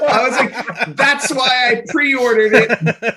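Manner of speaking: high, loud, laughing "I know"; slow, laughing exasperation over the pre-order; exasperated outburst. Retold or imitated. Exasperated customer retelling the call.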